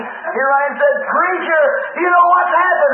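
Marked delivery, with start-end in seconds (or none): 1.1-2.9 higher, urgent call to the preacher; excited news-bringer. Retold or imitated. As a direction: burst in with big news. Acted excited news from hospital.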